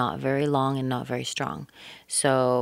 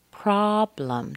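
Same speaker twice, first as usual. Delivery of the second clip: slow PROBLEM, first syllable stretched; two clear syllables; 5 semitones higher than usual. Shown as a demonstration. Teacher counts two syllables, stressing the first of PROBLEM. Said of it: "two syllables".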